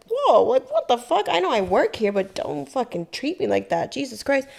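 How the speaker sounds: offended waitress telling off a groping customer; indignant outburst. Retold or imitated. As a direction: snap back at him. Retold confronting groping customer.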